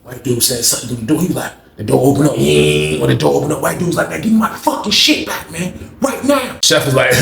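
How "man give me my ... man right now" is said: high, tense demand; mock angry demand. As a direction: demand it back, fuming.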